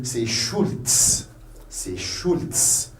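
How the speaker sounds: "Schultz" ending in a sharp ts; slow "Schultz", crisp voiceless ts at the end; German Z as TS. Shown as a demonstration. Teacher showing learners the German surname, Z as TS. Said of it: "ça se prononce comme le T S".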